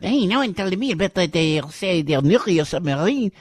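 high, loud mock French Macron; mock French accent. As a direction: whine it like upset Macron. Mocking Macron upset.

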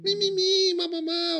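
put-on high-pitched baby babble; playing a baby. Shown as a demonstration. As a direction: babble in syllables like a baby at play. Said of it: "brincar de ser um bebê". (balbucio agudo de bebê)

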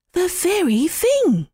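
a quick, pleased little sparrow. Acted. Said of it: "said the sparrow".

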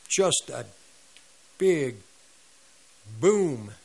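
"boom" as a loud, falling thud; dramatic crash. Retold or imitated. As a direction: set up "boom" word by word, then drop it hard. Imitating the crash to the ground.

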